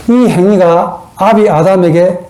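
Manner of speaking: ordinary delivery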